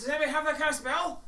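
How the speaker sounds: high, loud, fast desperate plea; panicking companion. Acted. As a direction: beg the group urgently for help. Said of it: "He goes".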